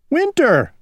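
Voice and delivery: high sharp cry of alarm. Acted. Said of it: "cried Owl".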